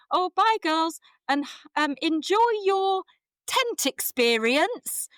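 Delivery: high, loud, very slow 'tent experience'; odd cheery goodbye. Retold or imitated. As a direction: sing it out oddly to the girls. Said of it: "looked at me like I was mad".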